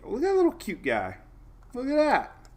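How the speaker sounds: mock-adoring coo at the map. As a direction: coo over it like a puppy. Cooing at a cute weather low.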